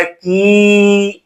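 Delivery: "कि" with the i held three or four beats; prolonged vowel. Shown as a demonstration. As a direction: draw "कि" out long on a level note. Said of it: "इसमें तीन या चार गुना कहा". ("कि" की ई को तीन-चार गुना खींचकर)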